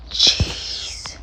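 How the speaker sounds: breathy whispered word. Real, not acted. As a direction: say it under your breath. Whispered item name.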